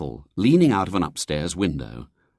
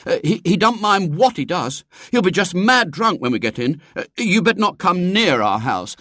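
put-on high, loud, stammering boy; desperate; 12 semitones higher, 5 dB louder than usual. A narrator's reading. William warns lady off his house, desperate. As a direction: plead urgently to keep them away. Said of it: "said William desperately".

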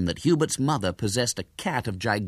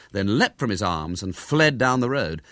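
plain reading voice

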